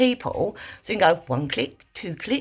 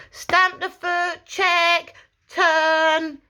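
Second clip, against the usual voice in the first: much higher, counted out like dance steps; rhythmic sharp cues; 9 semitones higher, much slower than usual. Shown as a demonstration. Trainer calling footwork like a dance routine. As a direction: call the steps like a dance caller. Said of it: "like a dance move".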